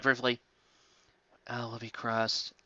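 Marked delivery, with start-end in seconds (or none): 1.5-2.5 soft, slow sorrowful whisper. Acted. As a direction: grieve under your breath. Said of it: "I said sorrowfully".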